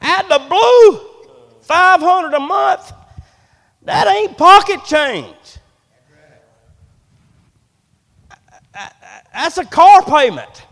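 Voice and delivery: preacher's fervent punch on the price; emphatic preaching. Real, not acted. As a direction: hammer each phrase to the congregation. Preacher hammers a point.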